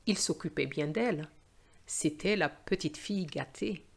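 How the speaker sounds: usual speaking voice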